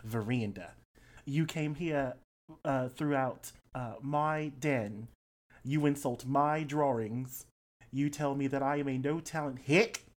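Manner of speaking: an offended Australian artist, pausing between phrases; Florals in Spring. Acted. Accusing visitors of insulting his drawings, affronted Australian. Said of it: "he says".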